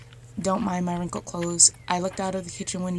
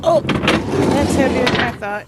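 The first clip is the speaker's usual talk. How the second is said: straining; 6 semitones higher, 8 dB louder than usual. Real, not acted. Strains lifting heavy panel.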